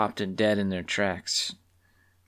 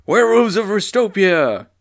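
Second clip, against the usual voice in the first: loud, higher booming call to the werewolves; booming proclamation; 10 semitones higher, 7 dB louder than usual. Acted. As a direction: boom it out over the crowd. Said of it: "a booming voice of the robed figure".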